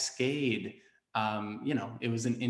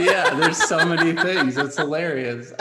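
laughing; 7 semitones higher, 10 dB louder than usual. Real, not acted. Laughing at shared pandemic urges.

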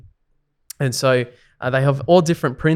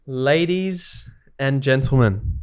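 announcer voice; 2 semitones higher, 4 dB louder, much slower than usual. Acted. Mock announcer segment intro.

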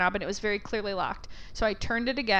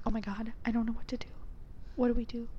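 quick, soft worried murmur; imitated hushed aside; 7 dB quieter, faster than usual. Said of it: "she was, like, whispering to her coworker".

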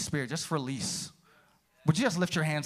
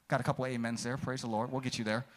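normal talking voice

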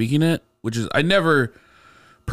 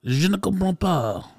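slow, high, mock-French grandma; hammed-up French; 7 semitones higher, slower than usual. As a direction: lay the French accent on thick, slowly. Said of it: "I wanted to lean into the accent".

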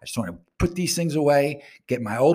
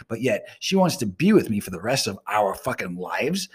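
usual speaking voice

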